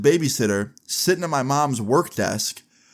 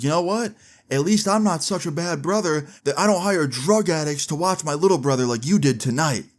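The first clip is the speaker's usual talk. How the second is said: raised pitch, pointed acted comeback; argumentative jab; 5 semitones higher than usual. Oldest brother arguing with mom, acted spiteful comeback. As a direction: imitate a brother out to hurt in an argument.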